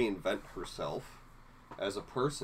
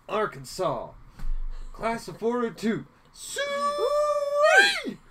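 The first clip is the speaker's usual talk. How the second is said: country bumpkin Roy; 9 dB louder, much slower than usual. Retold or imitated. Co-host quoting Roy's alma mater, hammed-up country bumpkin. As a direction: ham up a country boy whooping his class cheer. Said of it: "Charles refers to as a country bumpkin".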